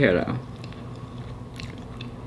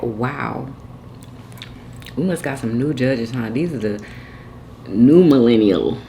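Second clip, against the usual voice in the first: mock amazement; 4 semitones lower than usual. Sarcastic imagined remark.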